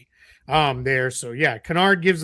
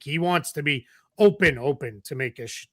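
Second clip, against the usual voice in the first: normal talking voice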